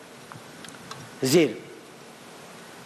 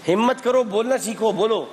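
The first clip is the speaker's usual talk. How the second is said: pushing her to speak up, higher; urging, higher; 6 semitones higher than usual. Real, not acted. Quiz host to shy contestant, urging her higher. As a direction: come on, find the courage.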